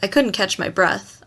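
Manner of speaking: ordinary delivery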